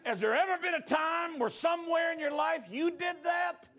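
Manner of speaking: high, loud, slow shouted preaching; preaching at full force. Acted. Preacher urging the congregation to answer, in full shouted fervour. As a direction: build the altar call to a roar.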